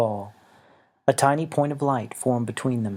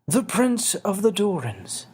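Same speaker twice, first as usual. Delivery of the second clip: put-on higher, slow regretful old voice; little winged man, sorrowful; 9 semitones higher than usual. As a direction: break bad news gently to a friend. Xavier's voice.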